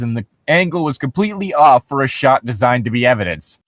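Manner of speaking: normal talking voice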